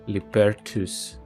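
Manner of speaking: ordinary delivery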